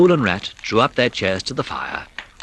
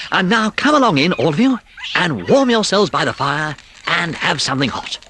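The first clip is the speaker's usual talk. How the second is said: louder, hospitable invitation; hearty welcome; 7 semitones higher, 4 dB louder than usual. A narrator's reading. Rat inviting the field mice in, heartily. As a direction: usher them in to the fire. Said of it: "cried the Rat heartily".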